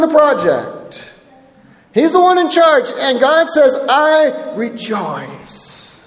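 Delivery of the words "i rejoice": slow rejoicing. Acted. Preacher voicing God slowly.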